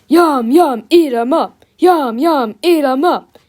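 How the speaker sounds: loud, repetitive monster chant; bellowing. A narrator's reading.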